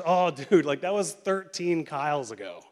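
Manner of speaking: loud, playful brush-off. Acted. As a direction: wave away your past self with good humor. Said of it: "Like, it's playful".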